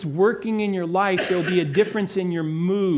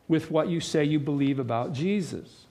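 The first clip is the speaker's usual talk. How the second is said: ordinary talk, nothing marked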